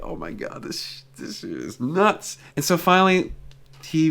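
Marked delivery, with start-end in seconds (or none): 0.0-2.4 whispered, slow oh my God; hushed disbelief under his breath; inner disbelief. Retold or imitated. Witness thinking to himself beside the judge, hushed whisper.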